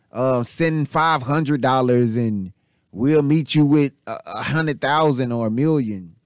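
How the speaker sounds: rough Jamaican accent, half-joking, imitating a scam caller; sketchy Jamaican British accent mimicking a scam caller; Jamaican scammer impression. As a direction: put on a shaky Jamaican accent like a scammer on the phone. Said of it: "it sounds Jamaican British".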